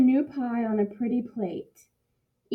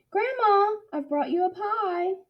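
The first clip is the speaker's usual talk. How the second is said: high call through the door; 9 semitones higher than usual. A narrator's reading. Girl calling to Grandma at her door, a high call. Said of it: "knocked on the door and called".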